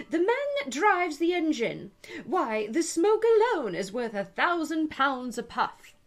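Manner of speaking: high, loud crowd of voices; chorus of voices. Acted. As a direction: passengers chanting at Alice together.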